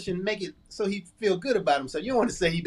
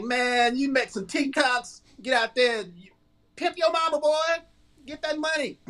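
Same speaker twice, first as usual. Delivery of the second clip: higher, egging-on voice; hyped hustler voice; 7 semitones higher than usual. Retold or imitated. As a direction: hype up the kid to cash in.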